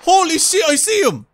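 loud, high startled yell. Real, not acted. Gamer spots the horror monster, startled yell. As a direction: blurt it out as the monster appears.

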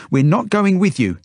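put-on high, loud child's refusal; defiant yell. A narrator's reading. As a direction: yell back at the captor. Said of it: "she yells at the woman".

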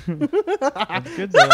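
high laughing words. Real, not acted. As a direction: say it through a laugh. Laughing at friend's hit.

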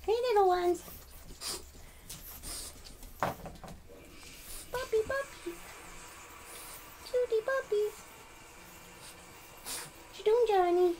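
high sing-song puppy talk; high cooing pet voice; baby talk to puppies. Acted. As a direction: coo at the dogs in a high voice.

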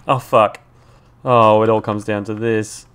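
loud dread. Real, not acted. Cornered behind a tree.

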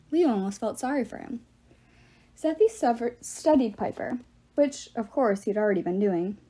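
ordinary delivery

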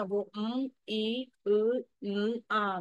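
मिलना broken into separate sounds; drawn-out separate sounds of मिलना; sounded out. Shown as a demonstration. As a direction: sound out each letter slowly, one by one. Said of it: "मिलना बोलेंगे ना".

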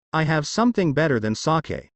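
plain reading voice

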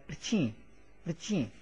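"rchi" starting on r, final i nasalized; r-led nasal syllable. Shown as a demonstration. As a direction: run r into a nasal "cheen" in "rchi". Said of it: "This part is read like".

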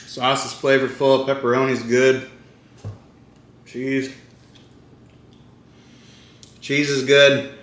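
chewing while talking; eating pizza mid-sentence; mouth full. Real, not acted. Seen 1: Holds pizza at mouth, takes bites and chews between words.

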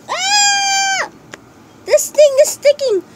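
high, loud alarmed cry; startled yelp. Real, not acted. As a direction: yelp as the slime grabs your hand. Slime stuck on his hand.

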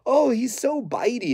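high, doting voice of an owner; mocking puppy owner. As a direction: trainer mocks owners who coddle bitey puppies. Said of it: "people allow where they're like".

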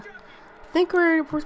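ordinary delivery

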